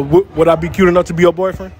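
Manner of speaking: usual speaking voice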